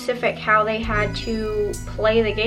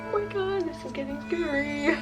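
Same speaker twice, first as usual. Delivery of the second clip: nervous night vlog whisper; 4 semitones higher, 8 dB quieter than usual. Acted. Scared reaction to lit candle.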